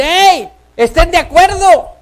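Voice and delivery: high, loud call to agree; shouted command. Acted. Preacher voices scripture command.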